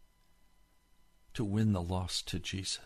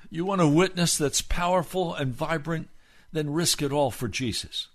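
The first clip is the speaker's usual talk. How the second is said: rising, louder preacher's call, pressing each question; preaching at full force; 8 semitones higher, 7 dB louder than usual. Acted. Pastor challenging the online congregation, loud, high preaching. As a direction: hammer the questions home to the congregation.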